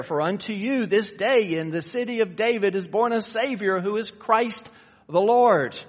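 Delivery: loud, driving sermon delivery; full-force preaching. Acted. Preacher proclaiming Christ to the congregation, loud and driving. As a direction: proclaim it from the pulpit.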